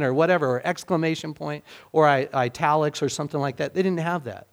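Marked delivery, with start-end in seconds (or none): none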